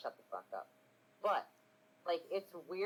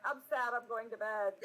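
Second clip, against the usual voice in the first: higher-pitched hammed-up sadness; mock sad; 8 semitones higher than usual. Streamer imitates her other sound alert, mock sad. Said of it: "and the other one's like".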